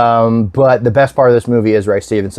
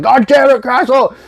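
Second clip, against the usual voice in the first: pissed-off shout; 12 semitones higher than usual. Retold or imitated. Cop yelling at Castle after the shotgun kill, mock angry shout. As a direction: bark it out like a fed-up cop. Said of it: "very pissed off at him".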